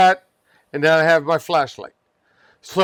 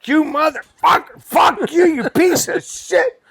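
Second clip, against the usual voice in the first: high furious yelling curses; swearing at the snake; 10 semitones higher than usual. Retold or imitated. Worker cursing at a dead snake, furious yell. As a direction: scream curses in a panic. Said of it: "I'm swearing".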